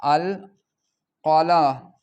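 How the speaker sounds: al-qalaa drawn out syllable by syllable; drawn-out demo. Shown as a demonstration. Teacher slowly sounding out a word for pupils. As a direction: stretch القلا- out very slowly. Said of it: "مثلًا نقول".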